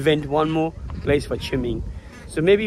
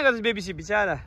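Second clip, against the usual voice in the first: ordinary talk, nothing marked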